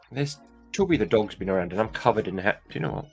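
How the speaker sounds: usual speaking voice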